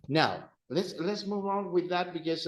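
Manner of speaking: ordinary talk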